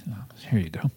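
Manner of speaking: whispered. Acted. Aside handing canapes.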